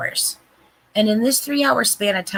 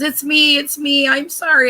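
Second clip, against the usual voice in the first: mock crying confession; 8 semitones higher, 5 dB louder than usual. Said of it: "she started crying and admitted".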